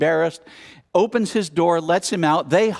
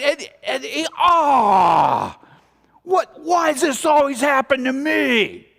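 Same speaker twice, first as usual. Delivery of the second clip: self-pitying wail; 9 semitones higher, 3 dB louder, slower than usual. Acted. Slams head in sink.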